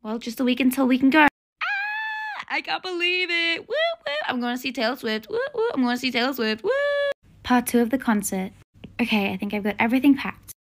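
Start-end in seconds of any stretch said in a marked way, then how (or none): 1.2-6.7 high squeals and whoops of joy; excited squealing. Acted. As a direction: cheer like you can't contain it. Teen celebrates concert alone.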